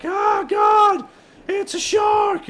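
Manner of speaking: high, shrieking mock panic, stretched out; hammed-up shark scream; terrified shriek. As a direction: squeal like you just spotted a fin. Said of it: "and I'm all like".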